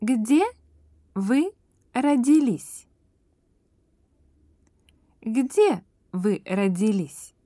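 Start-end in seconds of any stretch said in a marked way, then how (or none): none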